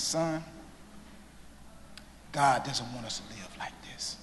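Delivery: tearful mother's whisper. Retold or imitated. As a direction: breathe it out as a heartbroken mother. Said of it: "And she says".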